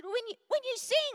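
imitating a blunt complainer; acted exasperated retort. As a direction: throw it back high and loud, mimicking a critic. Retells man's criticism, acted.

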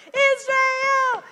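high, loud chanted Israel; joyful shout. Retold or imitated. Preacher imitating the crowd, shouting high and loud. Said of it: "then they're going".

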